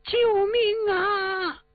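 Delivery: drawn-out high, far-off scream for help; a woman's desperate wail from the woods; cry for help. Acted. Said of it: "喊救声音". (远处女人拖长的高声呼救)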